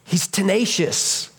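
ordinary delivery

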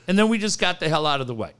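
normal talking voice

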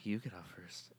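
soft playful murmur. Pretend. Phone bit sign-off.

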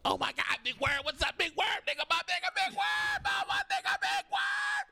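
mom's excited greeting done in a Chris Tucker voice; mom sounding like Chris Tucker. Retold or imitated. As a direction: squeal his name like Chris Tucker. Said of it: "my mom sounds like Chris Tucker".

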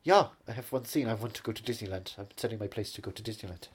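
put-on measured German-accented English; German film-director accent. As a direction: philosophize in a thick German accent.

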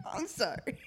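breathless giggly sorry; whispered laughing apology. Real, not acted. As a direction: gasp it out between laughs.

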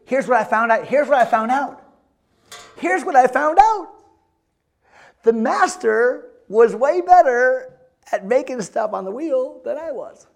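loud, high, insistent sermon delivery; full-force preaching. Acted. Preacher telling what he found out at the wheel, full-force preaching. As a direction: build to a high, emphatic declaration.

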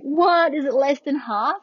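high, loud startled question; shocked disbelief. Retold or imitated. Patient hearing bad news from partner, shocked disbelief. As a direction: act out an alarmed double-check. Retold shock at low dilation.

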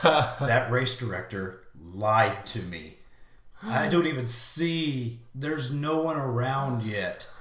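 loud, slow, drawn-out grievance; outraged disbelief. Retold or imitated. Retold outrage at missing finish line.

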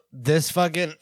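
coughing through the words after a bug; just swallowed a bug. Real, not acted. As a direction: choke out words while coughing. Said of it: "I inhaled a, s-, uh, like, a bug".

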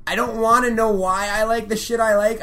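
retold fan snapping back; angry rant. Comic acting out Patton ranting at Lucas, heated defiance. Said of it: "And he goes".

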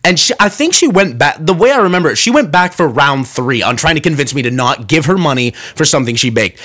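usual speaking voice

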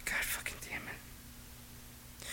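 exasperated whisper under breath; whispered curse. Real, not acted. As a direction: hiss a slow frustrated curse. Frustrated at co-host's wrong name.

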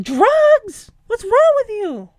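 squawky high duck voices scolding; high, nasal duckling disbelief; cartoon ducks. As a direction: play a shocked cartoon duck. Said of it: "they're like".

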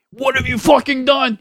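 imitating a dad raging at his son; angry accusing. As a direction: throw it at him as an accusation. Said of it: "he wasn't saying it in, like".

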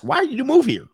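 high-pitched mock curiosity; mock prying question. Shown as a demonstration. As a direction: ask it high, as a put-on question. Acts out a question not needed.

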